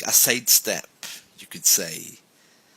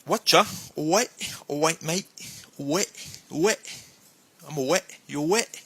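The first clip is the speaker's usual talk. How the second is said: London street greeting; 6 semitones higher than usual. Shown as a demonstration. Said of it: "you might be greeted with something like".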